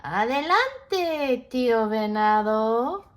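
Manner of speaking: winning toad calling to the deer; calling back. Acted. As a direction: call back cheerfully. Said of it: "Sapo called back".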